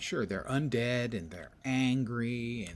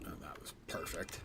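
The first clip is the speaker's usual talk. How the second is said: sarcastic; 4 semitones lower, 7 dB quieter than usual. Real, not acted. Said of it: "that was sarcasm".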